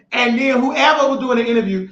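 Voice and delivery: normal talking voice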